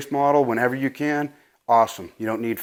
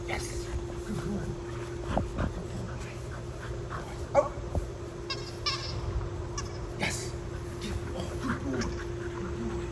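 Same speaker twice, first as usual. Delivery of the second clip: soft praise; 10 semitones higher, 15 dB quieter than usual. Real, not acted.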